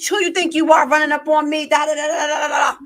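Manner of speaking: high, loud mock comeback; popping off. Daughter talking back to her boss in a high, loud comeback. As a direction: fire back like you're ready to fight. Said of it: "Instead of my daughter popping off like".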